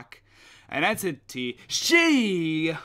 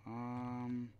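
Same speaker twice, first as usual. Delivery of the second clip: normal talking voice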